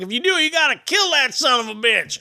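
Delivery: high-pitched, emphatic imitation; put-on high voice, insistent; drunk fishing regular. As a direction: mimic a local laying down the law. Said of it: "He's like".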